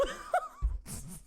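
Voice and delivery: high squealing giggle; high laugh. Real, not acted. Laugh after elite joke.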